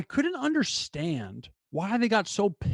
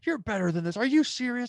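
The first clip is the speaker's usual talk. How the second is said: higher pitched, indignant scolding; mock scolding dean; 9 semitones higher than usual. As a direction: imitate the dean going off at the girl. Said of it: "talking to the girl".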